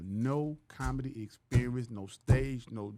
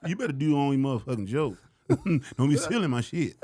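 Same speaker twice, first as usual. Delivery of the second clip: loud, laughing mock warning; mock threat; 6 semitones higher, 9 dB louder than usual. Bernie warning the new guy, loud and laughing. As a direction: play Bernie warning him off, half laughing.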